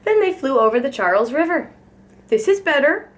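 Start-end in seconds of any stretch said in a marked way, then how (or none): 2.3-3.1 higher, hopeful quack. A narrator's reading. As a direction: say it with rising hope. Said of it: "quacked Mister Mallard hopefully".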